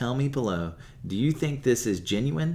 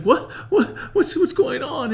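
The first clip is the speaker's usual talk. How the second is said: much higher, louder, laughing; panicked confusion; 8 dB louder than usual. Retold or imitated. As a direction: sputter in disbelief. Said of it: "He's like".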